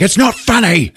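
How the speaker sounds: high-pitched indignant outburst; angry chief. Retold or imitated. Chief at laughing detective, angry outburst. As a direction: imitate an irate police chief.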